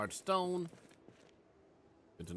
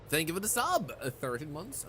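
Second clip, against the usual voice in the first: ordinary talk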